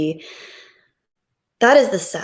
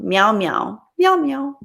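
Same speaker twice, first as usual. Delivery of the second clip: kitty meow; 3 semitones higher, slower than usual. Pretend. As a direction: meow like a cute kitten. Playful cat noises.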